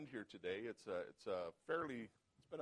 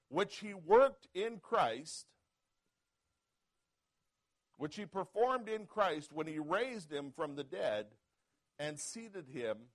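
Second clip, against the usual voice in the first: slow, louder scripture proclamation; slow preached reading; 4 semitones higher, 7 dB louder, slower than usual. Acted. Pastor reading of Christ raised from the dead, preaching slowly. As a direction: proclaim each phrase slowly. Pastor reads Ephesians aloud.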